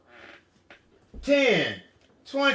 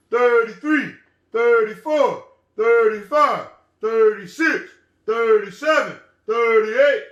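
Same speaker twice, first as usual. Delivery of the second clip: big, loud deep-voiced counting; real deep voice; 5 dB louder than usual. Shown as a demonstration. Teacher counting with children in a real deep voice. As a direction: boom each number out deep. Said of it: "In a real deep voice".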